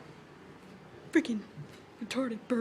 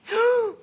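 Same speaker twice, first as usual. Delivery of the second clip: gasp; 7 semitones higher, 10 dB louder than usual. Real, not acted. Startled by a second bird.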